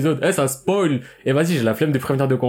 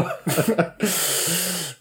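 soft laugh; 6 semitones higher, 4 dB quieter than usual. Real, not acted. Laughs at own story.

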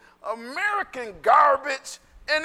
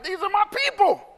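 Jesus claiming his own; 10 semitones higher than usual. Acted. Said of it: "He's like".

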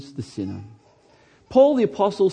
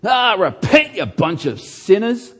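loud, high, arrogant mock condemnation; judgmental yell; 5 semitones higher, 6 dB louder than usual. Shown as a demonstration. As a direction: bark it like a self-righteous preacher. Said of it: "hateful, arrogant, intolerant, judgmental spirit".

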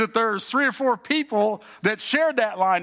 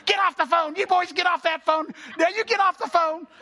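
put-on higher, nagging old neighbor voice; mock scolding neighbor; 8 semitones higher than usual. Neighbor scolding the boys hogging the party line, high mock nag.

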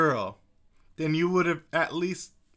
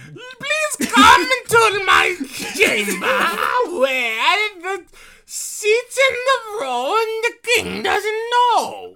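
high, slow wailing croon; rich voice; 13 semitones higher, 6 dB louder, much slower than usual. Pretend. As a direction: croon it like a grand singer. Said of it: "That's my rich voice".

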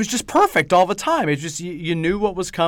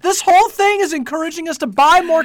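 mock outrage; 12 semitones higher, 8 dB louder than usual.

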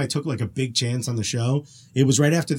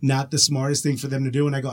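ordinary delivery

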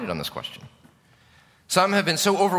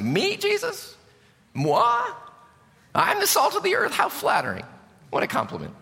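flattered individualist. Pretend. Mock individualist hearing Jesus' words.

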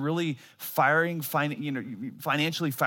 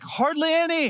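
imitating wife; 13 semitones higher, 4 dB louder than usual. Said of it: "She's like".

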